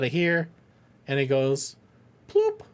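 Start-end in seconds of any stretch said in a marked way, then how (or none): 2.3-2.6 a very high, popping "ploop"; ploop sound effect. Pretend. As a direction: pop it out like a cartoon machine. Voicing the machine's output.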